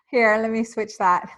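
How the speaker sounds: normal talking voice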